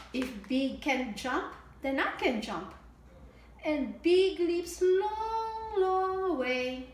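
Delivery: bright, sing-song storybook read; lilting picture-book reading; reading to children. A narrator's reading. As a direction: read it out like storytime for little ones.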